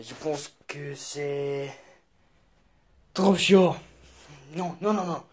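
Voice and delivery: speaking French in a put-on French voice; hammed-up French accent; mock French accent. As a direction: play an overdone Frenchman.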